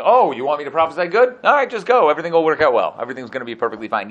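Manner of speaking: loud, high mock-cheerful prophecy; sarcastic. Prophet mocking the king's demand, loud sarcastic cheer. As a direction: brush the king off with sarcastic cheer. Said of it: "he was being completely and utterly sarcastic".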